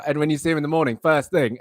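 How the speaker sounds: normal talking voice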